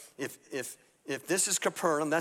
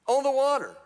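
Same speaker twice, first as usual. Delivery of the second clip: astonished; 9 semitones higher, 8 dB louder than usual. Acted. Astonished at man on water.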